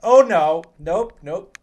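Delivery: real fright, yelling no; startled scream. Real, not acted. Player jumped by a monster, real panicked yell. Jump scare in horror game.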